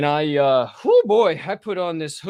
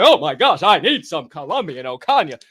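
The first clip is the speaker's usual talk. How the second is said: excited customer; 8 dB louder than usual. Retold or imitated. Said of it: "They say".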